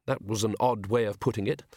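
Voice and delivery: usual speaking voice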